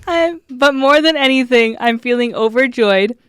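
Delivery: fighting laughter to finish the card; laughing through tears. Real, not acted. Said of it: "I'm crying".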